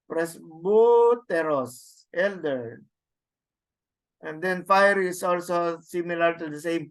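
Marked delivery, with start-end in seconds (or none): 0.1-2.0 presbyteros spelled out very slowly, each syllable clear; slow demo word. Shown as a demonstration. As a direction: stretch presbyteros out for the students. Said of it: "teaching the Greek word".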